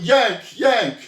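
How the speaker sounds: high, loud yell of a name; retold scream. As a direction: bellow the name across the room. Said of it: "he's screaming".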